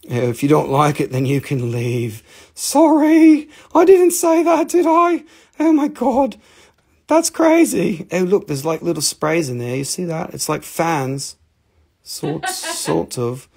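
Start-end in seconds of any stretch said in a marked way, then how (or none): 2.6-8.1 very high, squeaky put-on voice; mock apology. Seller takes back telling viewers to leave, high mock apology. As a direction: squeal in exaggerated, louder mock horror.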